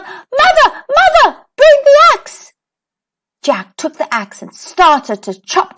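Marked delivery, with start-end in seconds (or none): 0.0-2.5 much higher, loud urgent yell; yelling for mother. A narrator's reading. Jack fleeing down the beanstalk, calling mother: urgent yell. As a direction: shout down to mother in a panic. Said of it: "and yelled".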